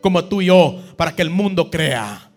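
loud, fervent preaching to the congregation; impassioned pastor rallying his church; preaching at full force. Acted. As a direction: drive the call to unity home from the pulpit. Preaching the climax of the sermon.

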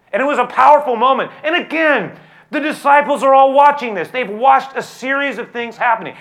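loud, high pulpit delivery; full force preaching. Acted. Pastor driving home the disciples' moment, fiery preaching. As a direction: preach it out to the back row.

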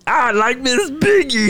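high, slow Kermit voice, laughing through it; breaking into laughs mid-Kermit; Kermit the Frog. Acted. Still doing Kermit for his friends, laughing. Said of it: "how does that sound?".

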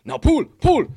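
loud, high barked command; bellowing instructor. Retold or imitated. Heavyweight coaching him through the move, loud and high. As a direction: shout the drill at him. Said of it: "deep, bellowing voice".

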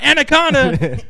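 high, loud blurt; the whole group shouting it. Retold or imitated. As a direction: yell it out eagerly. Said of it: "they all scream out".

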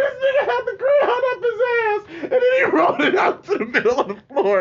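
high, loud, breaking into laughter; can barely get it out for laughing; laughing hysterically. Real, not acted. Telling a friend the gross crayon reveal, laughing hard.

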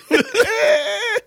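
wheezing high laugh; high crying laugh. Real, not acted. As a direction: laugh till it squeaks. Mocking A-Rod's bad call.

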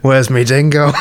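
laughing attempt at an Australian accent; jokey put-on Australian twang. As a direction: have a go at cartoonish Australian. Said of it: "can't do an Australian accent".

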